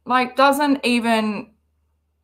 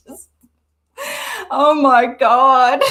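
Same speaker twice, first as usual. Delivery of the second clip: real helpless laughter through the words; laughing; 2 semitones higher, slower than usual. Real, not acted. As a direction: she can barely get it out for laughing. Laughing fit.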